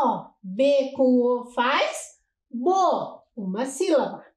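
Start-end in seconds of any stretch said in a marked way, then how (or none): 0.4-3.2 slow, drawn-out spelling of each sound; slow syllable demo. Shown as a demonstration. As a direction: spell it out slowly for children. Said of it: "Olhem lá". (soletrando devagar)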